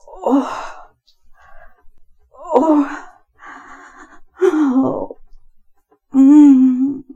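breathy "oh"s swelling into a loud hum; repeated acted moans. Acted pleasure in intimate narration.

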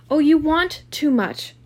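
loud, high, slow wail of protest; drawn-out anguished outburst; crying out. A narrator's reading. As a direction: cry out in distress. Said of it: "she cried to Gatsby".

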